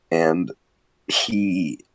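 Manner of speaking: usual speaking voice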